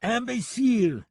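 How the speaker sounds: high, loud, drawn-out French insult; taunting. Acted. Amused French gunman repeats insult to deputy, taunting. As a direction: tease him slowly in French. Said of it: "Lipov only chuckled".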